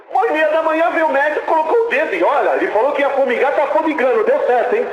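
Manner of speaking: high, loud, rushing with wonder; a pastor overcome by the wonder of the healing; excited preaching. Real, not acted. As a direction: tell the miracle at full fervor. Retelling a miracle excitedly.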